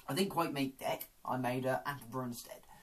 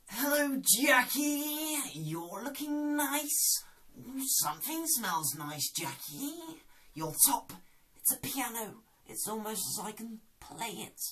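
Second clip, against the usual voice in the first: Jim impression; 7 semitones higher than usual. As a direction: croon at Jackie from high up. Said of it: "the next one is Jim from The Inbetweeners".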